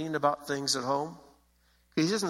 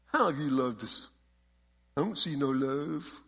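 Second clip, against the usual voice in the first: a lightly voiced sulky punk kid; hint of a whiny teen asking back; mild punk attitude. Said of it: "imagine every one of these questions in that kind of a punk voice".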